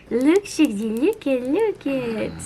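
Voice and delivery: slow, gentle coaxing. Real, not acted. Owner gently coaxes her cat to face the camera. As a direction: coax the cat to look at the camera.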